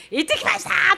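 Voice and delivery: high, bright triumphant report; proud student. Retold or imitated. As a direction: announce it like you conquered the mountain. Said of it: "まるで別人のように". (登頂した生徒の誇らしげな高い声)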